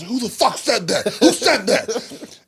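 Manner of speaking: high, loud, drawn-out yell; retold yell. Wrestler yells into the crowd at a heckler, loud and slow. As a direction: shout it at the back of the room.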